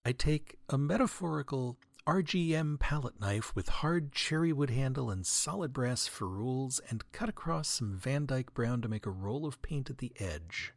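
normal talking voice

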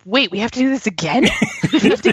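high-pitched, laughing mock dismay; baffled voter, voice leaping up on the questions; dismayed disbelief. Speaker voicing voters told to do it again, dismayed disbelief.

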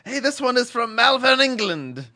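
a light put-on British accent reading the email. Host reading an English listener's email in a lightly put-on British accent. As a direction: read the letter in a mock English voice. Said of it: "you have not improved your British accent".

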